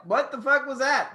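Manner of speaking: ordinary talk, nothing marked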